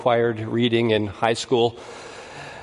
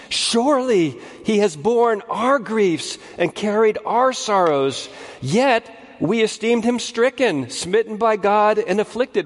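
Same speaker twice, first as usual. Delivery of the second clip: raised, higher solemn reading of the verse; scripture reading; 7 semitones higher than usual. Acted. Preacher to congregation, reading Isaiah in a raised proclaiming voice. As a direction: lift the text up like proclaiming it.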